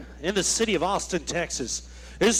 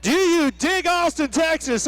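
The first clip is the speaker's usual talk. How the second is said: crowd hype call; 6 semitones higher than usual. Acted. Hyping the crowd.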